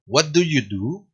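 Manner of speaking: What do you do? said slowly with the pitch rising on the final do; slow question lifting at the end; rise at the end. Shown as a demonstration. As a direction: rise only on the last "do". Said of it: "recién al final".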